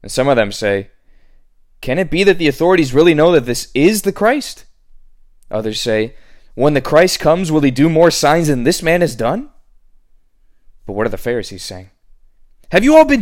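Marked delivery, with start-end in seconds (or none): 1.8-4.7 higher, louder questioning voice; raised voice of a puzzled onlooker; wondering crowd. Acted. People in crowd wondering aloud. 6.5-9.5 higher, louder wondering voice; raised voice of a doubting bystander; questioning crowd. Acted.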